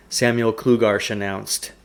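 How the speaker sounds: plain reading voice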